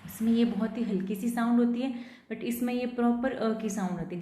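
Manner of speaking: usual speaking voice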